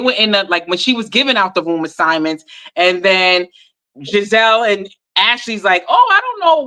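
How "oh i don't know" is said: high-pitched mimicked cluelessness; mock puzzlement in a high voice; feigned confusion. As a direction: play clueless, pitched well up. Mimics cast members feigning confusion.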